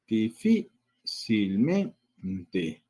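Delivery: "Difícilmente" very slowly as di, fí, cil, men, te; slow teacher's breakdown of "Difícilmente"; syllable by syllable. Shown as a demonstration. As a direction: sound out "Difícilmente" to show both stresses. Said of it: "Tenemos doble acentuación".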